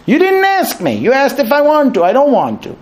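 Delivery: high, mock-pedantic husband; literal husband. Husband twisting his wife's garbage question, mock pedantic. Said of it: "And he'll say".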